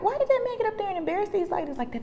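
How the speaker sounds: high, fast, pained questioning; incredulous complaint. Retold or imitated. Challenging church people over the embarrassment, incredulous high complaint. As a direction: rush the question up high in protest.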